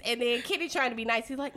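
ordinary talk

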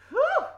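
high, loud blown-out "whew". Real, not acted. Preacher overwhelmed after urging surrender to God, loud high whew. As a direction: blow out a big overwhelmed whew.

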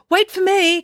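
high, loud cry to wait; calling out. Acted. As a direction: shout after the departing van. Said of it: "cried Loretta Viswanathan".

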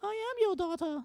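put-on high, eerie little girl voice; imitating a ghostly girl. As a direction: quote it like the creepy child from The Others. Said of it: "The Others".

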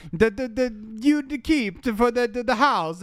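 a deliberately bad Italian accent; fake Italian accent. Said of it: "fake little Italian accent".

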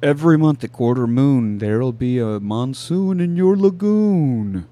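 drawn-out, singsong rhyme reading; game riddle recital. Acted. Player reading Jumanji's riddle, slow ominous recital. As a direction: intone the rhyme, slowing into the last line. Reads out the game's rhyming riddle.

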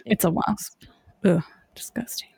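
whispered disgusted ugh; breathy grossed-out groan; annoyed ugh. Real, not acted. Disgust at wasps.

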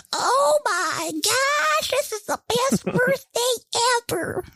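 very high, drawn-out falsetto squeal; hammed-up impression of an ecstatic mom; mom's overjoyed gushing. Imitating mom getting a gift from the sons, hammed-up excitement. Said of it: "my mom is the exact opposite".

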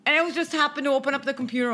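ordinary talk

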